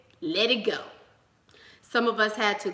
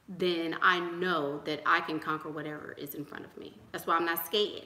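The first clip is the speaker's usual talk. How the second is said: normal talking voice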